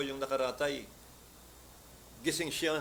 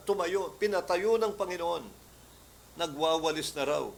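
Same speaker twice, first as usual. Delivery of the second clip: usual speaking voice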